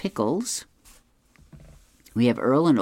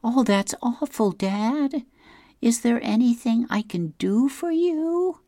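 high, sweet daughter's voice; 13 semitones higher than usual. Retold or imitated. Daughter leaning toward her dad to offer help, high sweet voice. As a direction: lift the voice way up for a caring daughter. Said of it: "the daughter leans forward and says".

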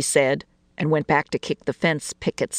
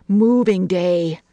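slow, drawn-out, louder exclamation; expressive; 3 semitones higher, 5 dB louder, much slower than usual. A narrator's reading. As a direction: stretch the words out expressively. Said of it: "she said expressively".

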